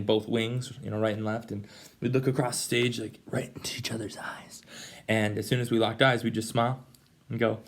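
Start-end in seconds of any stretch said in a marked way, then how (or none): 3.0-4.6 whisper. Real, not acted. Recalling stage glances.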